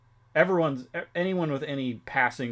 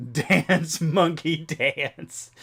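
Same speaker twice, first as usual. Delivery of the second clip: laughing, louder and higher playful order; mock command; 6 semitones higher, 6 dB louder, much slower than usual. Streamer joking about being made to perform, laughing mock command. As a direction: bark it as a joke through laughter.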